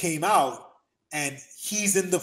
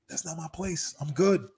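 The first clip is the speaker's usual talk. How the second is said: usual speaking voice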